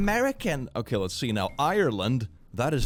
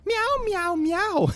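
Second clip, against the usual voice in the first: cat meowing; much slower than usual. Pretend. Meows like a cat.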